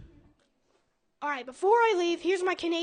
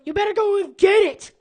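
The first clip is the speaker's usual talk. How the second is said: shouting; 4 semitones higher, 10 dB louder than usual. Acted. Punchline shout in the joke.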